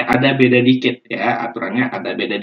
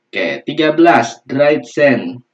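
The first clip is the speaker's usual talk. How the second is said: normal talking voice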